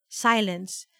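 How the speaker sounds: plain reading voice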